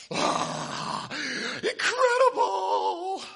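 high, loud, slow fake gasp of wonder; mock astonishment. Pastor pretending to marvel at the limp glove, exaggerated. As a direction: oversell the failed trick. Fake awe at failed trick.

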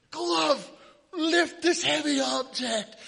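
high, loud, slow command; mock magician. Pastor redoing the lame trick for the congregation, hammed-up. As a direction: ham up the spell for the congregation.

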